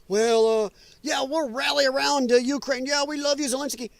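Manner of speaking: high, half-hearted mock cheerleading; mock allies. Streamer mocking countries rallying around Ukraine, high put-on voice. Mocks allies' show of support.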